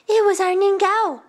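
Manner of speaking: put-on high, loud child's voice; explaining young boy. Youngest boy explaining to old woman, high and loud. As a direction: pipe up eagerly.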